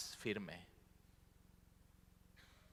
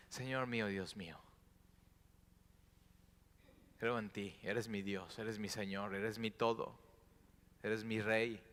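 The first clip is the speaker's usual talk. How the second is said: softer, devoted confession; tender prayer; 5 dB quieter than usual. Acted. Believer confessing faith to Jesus, soft and tender. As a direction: pray it quietly, with devotion.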